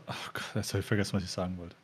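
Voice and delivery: fading to a near mumble; trailing off. Real, not acted. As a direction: let it fade out as you lose the thought. Forgot what she wanted to say.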